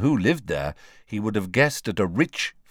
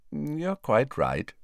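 slow, serene, higher-pitched reply; 6 semitones higher, slower than usual. A narrator's reading. Headmaster calmly concedes to his old colleague, serenely. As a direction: agree serenely, taking your time. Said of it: "said Dumbledore serenely".

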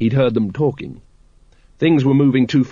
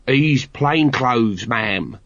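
crisp subordinate reporting to a superior; smart reply; much slower than usual. A narrator's reading. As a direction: answer ma'am smartly, in a put-on voice. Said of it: "said Nobby smartly".

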